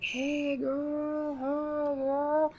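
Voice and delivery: quiet distant catcall; high catcall fading into the distance; the same catcall heard from far away. Retold or imitated. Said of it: "we get farther and farther".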